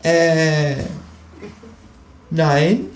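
high, hesitant denial; stall, then deny it in a high voice; drawn-out sheepish animal voice; caught-out kangaroo. A narrator's reading.

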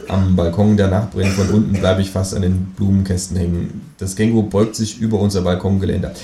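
ordinary delivery, nothing marked

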